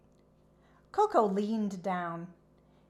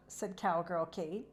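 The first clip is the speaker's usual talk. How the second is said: ordinary delivery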